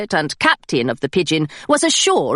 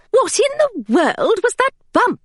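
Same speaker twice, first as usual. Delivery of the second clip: governess; 10 semitones higher than usual. Acted. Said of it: "she said".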